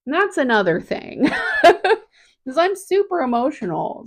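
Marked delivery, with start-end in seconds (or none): 1.3-2.0 a short laugh that jumps up high and loud; high teary laugh. Real, not acted. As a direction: laugh at yourself through tears. Laughs at own emotion.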